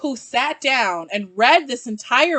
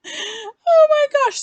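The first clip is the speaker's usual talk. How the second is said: laughing it out, much higher; breaking into real laughter at herself; embarrassed giggly gasp; 6 dB louder, much slower than usual. Real, not acted. Laughing at own slip.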